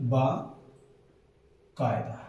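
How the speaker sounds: बाकायदा very slow and soft, broken after "baa"; slow syllable-by-syllable. Shown as a demonstration. Teacher sounding out the correct spelling, बाकायदा slowly in parts. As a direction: draw out बाकायदा as "baa ... kaayda". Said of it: "उच्चारण से ही पता चल जाएगा".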